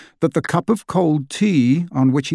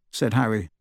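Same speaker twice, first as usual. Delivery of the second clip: ordinary delivery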